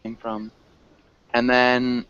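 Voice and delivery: ordinary delivery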